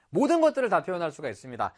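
usual speaking voice

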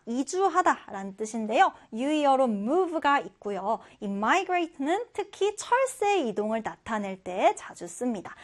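ordinary delivery

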